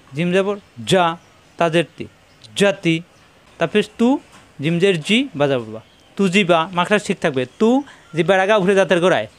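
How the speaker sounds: normal talking voice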